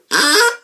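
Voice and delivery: sharp, loud, high honk; duck call honks. Shown as a demonstration. Testing duck calls on camera.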